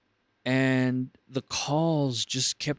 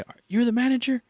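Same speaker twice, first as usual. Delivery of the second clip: high, loud indignant question; mock angry caller; 12 semitones higher, 5 dB louder, faster than usual. Irate caller demanding the manager, loud and high. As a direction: demand it sharply.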